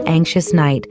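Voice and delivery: ordinary delivery, nothing marked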